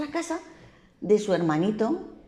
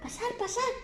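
welcoming piglet. A narrator's reading. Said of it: "y le dijo".

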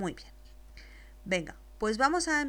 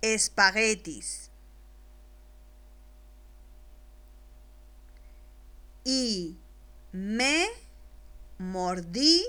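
slow dictation; much slower than usual. Shown as a demonstration. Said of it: "después te la dicto".

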